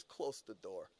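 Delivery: low, hushed command; quiet ominous order. Retold or imitated. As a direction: say it low and serious. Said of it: "he says".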